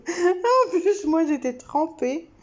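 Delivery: can't stop giggling while telling it; bursting into laughter mid-sentence; laughing. Real, not acted. Laughing while telling her drenched seat.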